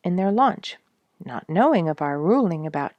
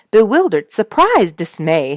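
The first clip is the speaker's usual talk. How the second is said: each word louder and higher; dramatic emphasis; 4 semitones higher, 8 dB louder than usual. A narrator's reading. Narrating the sprayed dog, loud and high. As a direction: punch each stunned word. Dog's reaction after spray.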